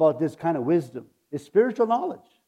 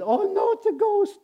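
frightened cry; 4 dB louder than usual. Retold or imitated. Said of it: "They said".

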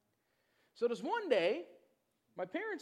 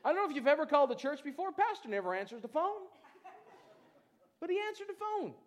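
amused amazement; 8 semitones higher, 8 dB louder than usual. Real, not acted. Laughing amazement.

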